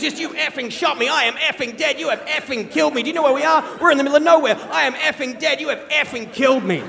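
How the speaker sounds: high-pitched, relentless mock rant; retold angry tirade. Wounded dad to his child, an acted tirade of abuse. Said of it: "unleashes this tirade of abuse".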